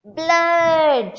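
a sudden high, loud scream of Blood!; the mother screams on seeing the bloody mongoose. Acted. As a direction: shriek it in horror at the sight. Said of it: "and screamed".

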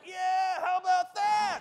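shouting back at the drivers in triumph, high and loud; taunting shout. Retold or imitated. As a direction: crow at them in triumph. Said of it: "I was like".

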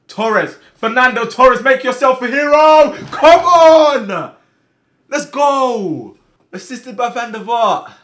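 very high, very loud celebration; ecstatic goal shout. Real, not acted. Player urges Torres to be a hero, ecstatic scream.

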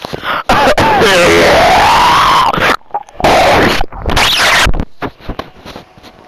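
loud screaming noise. Real, not acted.

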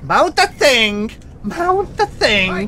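high, slow, loud pleading yell; frustrated yell. Real, not acted. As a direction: drag it out, begging the game to obey. Yelling at game controls.

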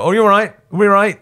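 high, loud English-accented greeting. Shown as a demonstration. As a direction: call out to the crowd in an English accent. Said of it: "shows just in an English accent".

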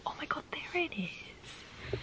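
a slow, breathless mock gasp of discovery; surprised whisper. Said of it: "And I was like".

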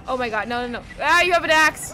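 loud alarmed outburst; startled yell. Real, not acted. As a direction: yelp at the sudden axe. Enemy with axe appears.

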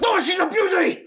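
shouted, high, super intense mock German; angry German accent. Shown as a demonstration. Host shows cohost the accent, angry mock-German shout. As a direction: bark it like a hammed-up angry German. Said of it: "Do, like, an angry, uh, like, a German or Russian accent".

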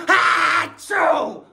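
a big, high, hammed-up sneeze; loud fake sneeze. Acted sneeze in skit.

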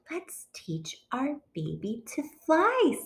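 soft, high, hushed proposal; soft suggestion. A narrator's reading. Goose to Duck about their baby, soft suggestion.

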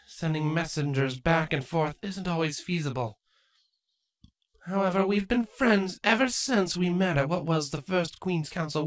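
lightly voiced queen, strained smile; Queen Chrysalis. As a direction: greet them, hiding anger.

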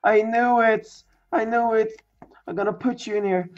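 high, loud excited crowing; elated gloating. Real, not acted. Gloats over the rare card.

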